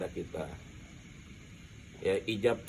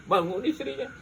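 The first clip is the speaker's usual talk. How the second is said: much higher, louder, laughing through the story; excited laughing telling; 13 semitones higher, 8 dB louder, faster than usual. Real, not acted. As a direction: burst out with glee at the punchline. Wife wakes, beats praying husband. (bercerita heboh sambil tertawa)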